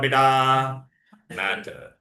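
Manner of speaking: usual speaking voice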